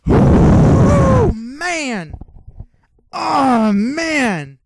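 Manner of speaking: very high, very loud anguished cry; strained, howling "oh man" after the drink; overwhelmed by the shot. Retold or imitated. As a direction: wail it as the strong shot burns. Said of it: "I was like".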